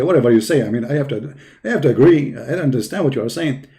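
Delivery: ordinary talk, nothing marked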